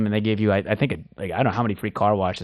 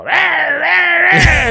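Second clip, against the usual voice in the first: high mocking rah rah, shouted; mock cheer shouted high and loud; 8 dB louder, much slower than usual. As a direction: jeer like a heckling crowd. Mocking critics cheering sarcastically.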